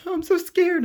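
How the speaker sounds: very high, whiny mock fear; high squeaky mockery of a coward; mocking scared voice. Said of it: "I'm not trying to make fun of anybody".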